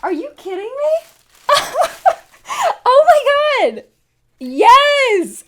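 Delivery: high, loud cry of surprise with a laugh; overjoyed disbelief, shouting it out; real shocked delight. Real, not acted. Surprise bonus item in unboxing.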